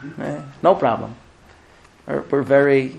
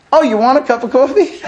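higher, laughing oblivious husband; clueless husband; 7 semitones higher, 3 dB louder than usual. Retold or imitated. Husband misreading his wife's hint, higher and laughing. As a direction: play it like the hint went right over his head.

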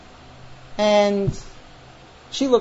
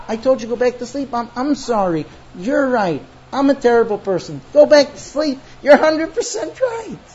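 high protest building to a loud cry; exasperated surrender; 6 semitones higher, 4 dB louder than usual. Acted. Crying wife telling husband to sleep, exasperated surrender. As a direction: concede angrily, climbing higher and louder. Wife argues back, voice rising.

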